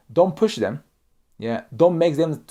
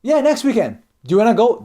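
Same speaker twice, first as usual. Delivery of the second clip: higher, louder pressing question; pushy invite; 8 semitones higher, 6 dB louder than usual. Shown as a demonstration. Speaker pressing a reluctant colleague, pushy. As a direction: push them to say yes. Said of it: "Don't say this".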